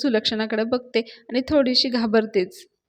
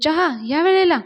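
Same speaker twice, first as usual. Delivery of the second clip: surprised question; 6 semitones higher, 6 dB louder than usual. Retold or imitated. Anna surprised at tea time.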